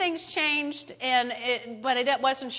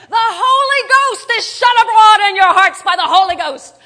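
much higher, much louder top-of-the-lungs yell; 9 semitones higher, 16 dB louder than usual. Retold or imitated. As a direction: scream it at the kids in the minivan. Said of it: "And I'd yell".

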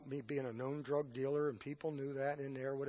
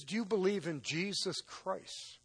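slow, higher-pitched counselor asking; voicing the counselor; 7 semitones higher, 4 dB louder, slower than usual. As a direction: lean in and ask it pointedly, slowly. Counselor voiced asking probing question.

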